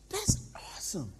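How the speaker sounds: whispered, impressed; whispered praise. Retold or imitated. Trainer quietly praising the pastor, whispered. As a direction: breathe the praise.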